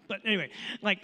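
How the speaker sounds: usual speaking voice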